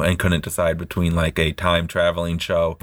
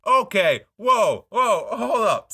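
high-pitched, drawn-out protest; mock alarm; 12 semitones higher, slower than usual. Real, not acted. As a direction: halt your friend in high-voiced disbelief. Mock alarm at friend's dog story.

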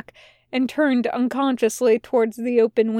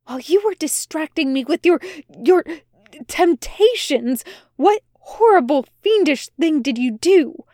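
angry accusation; 4 semitones higher than usual. A narrator's reading. Angel accusing demon angrily.

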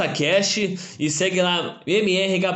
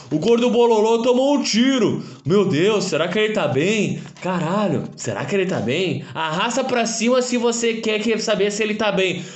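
mock clickbait voice; 6 semitones higher than usual. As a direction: ham up the fake concern. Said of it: "eles têm a pachorra de falar". (voz de influencer sensacionalista)

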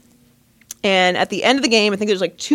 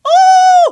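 loud, high-pitched whoop of shock; 11 dB louder than usual. Acted. Reacting to the woman's reply, loud shocked whoop. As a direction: hoot a big scandalized "oh!". Shocked outburst at story's turn.

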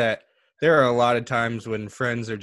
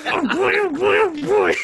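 squeaky duck voice, much higher, a bit louder; attempted Donald Duck; 5 dB louder, slower than usual. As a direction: squawk it out like a cartoon duck. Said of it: "All right, here we go".

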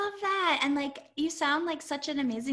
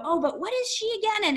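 high-pitched puzzled question; mock confusion; 9 semitones higher than usual. Astrologer imitating someone forgetting what a planet means, mock confused. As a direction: play a muddled beginner, voice lifted high. Acting out a baffled astrology client.

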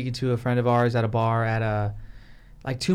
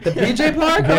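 much higher, louder incredulous squeal; laughing disbelief; 13 semitones higher, 7 dB louder than usual. Retold or imitated. Refusing to play at the park, high laughing disbelief. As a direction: balk at the gross idea. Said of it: "I'm like".

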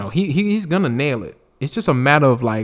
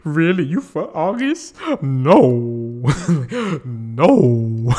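higher, louder disbelieving husband; heartbroken Will; 4 semitones higher, 4 dB louder, slower than usual. Retold or imitated. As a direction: plead in mock disbelief to his wife. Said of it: "He was like".

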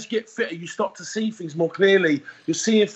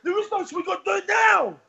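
insistent trainer; 12 semitones higher than usual. Retold or imitated. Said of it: "He's like".

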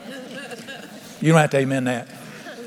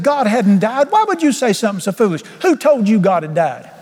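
raised-pitch mock scolding; mad husband; 5 semitones higher, 3 dB louder than usual. Husband challenging his wife's foolish claim, acted mad. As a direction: imitate an indignant husband demanding an answer. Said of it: "Now he's mad at her".

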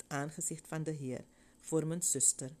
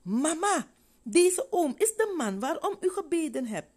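much higher and louder, calling out to mother; a girl shouting the good news; joyful call; 12 semitones higher, 8 dB louder than usual. A narrator's reading. (luid en blij roepend naar mama)

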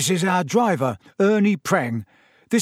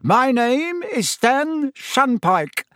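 high, loud conductor's patter; loud announcement; 9 semitones higher, 4 dB louder than usual. A narrator's reading. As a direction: call out like a conductor greeting passengers. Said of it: "began to speak loudly to the night".

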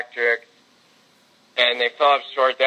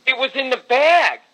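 much higher pitch, exasperated disbelief; exasperated emphasis; 10 semitones higher than usual. Real, not acted. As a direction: pitch jumps way up, insisting on a sure thing. Reliving a lost sure bet.

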